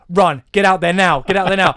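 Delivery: loud, high acted command to flee; urgent warning. Max warns Alex off the unicorn, urgent warning. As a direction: shout it like danger is coming.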